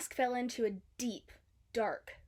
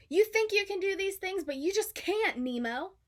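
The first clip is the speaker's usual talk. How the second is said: scolding father; 8 semitones higher, 5 dB louder than usual. A narrator's reading. Father scolding his son.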